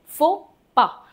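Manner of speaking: फोपा as "fo-pa", final s silent; French pronunciation. Shown as a demonstration. Teacher repeats फोपा for the class, fo-pa. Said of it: "एक्स और एस silent है".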